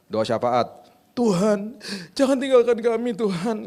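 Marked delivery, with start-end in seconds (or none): 1.2-3.7 much higher, whiny pleading cry; hammed-up sobbing plea to God; mock weeping prayer. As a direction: parody a tearful begging prayer. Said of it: "gitu, nangis-nangis".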